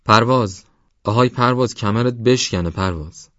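angry father shouting his son's name; rough angry shout. Acted. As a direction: bellow at the boy like a furious father.

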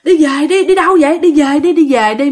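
retold balking, begging to go home; balking protest. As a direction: dig your heels in. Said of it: "mẹ Sáu dùng dằng".

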